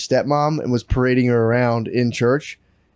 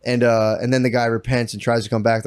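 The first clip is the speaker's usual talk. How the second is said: ordinary delivery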